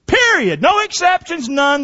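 high, shouted rule; firm shout of no exceptions; emphatic rule. Real, not acted. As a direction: hammer down the house rule. Stating mama's strict rule.

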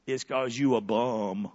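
ordinary talk

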